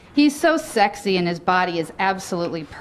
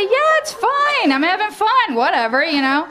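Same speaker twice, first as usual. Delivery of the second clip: breezy reassurance; 10 semitones higher than usual. Retold or imitated. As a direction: brush it off lightly, higher up. Her casual reply to Billy.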